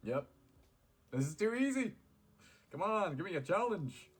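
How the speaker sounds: high, loud bragging taunt; cocky taunt. Acted. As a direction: show off to the game.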